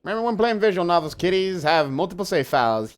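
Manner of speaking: loud, high, fast sing-song lecture; mock lecturing. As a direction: lecture the kiddies with smug glee. Lectures viewers as kiddies.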